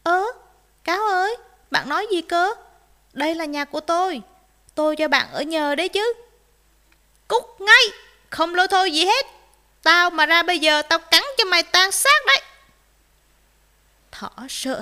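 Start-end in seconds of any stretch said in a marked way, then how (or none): 0.0-6.1 raised, louder puzzled protest; surprised. A narrator's reading. Rabbit is locked out by fox, surprised protest. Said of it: "Thỏ ngạc nhiên". 7.3-12.4 high, very loud threatening shout; angry threat. Acted. Fox threatens rabbit at the door, angry shouting.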